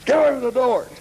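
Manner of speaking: desperate urgent warning; urgent plea. Retold or imitated. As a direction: beg him to get away from the door, slowing on the middle. Urgent plea about Frank.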